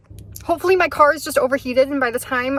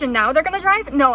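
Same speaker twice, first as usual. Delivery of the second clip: ordinary talk, nothing marked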